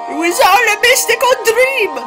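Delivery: very high sing-song announcement; high mock-dramatic. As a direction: proclaim it in a squeaky high voice. Dramatic narrator-style exclamation.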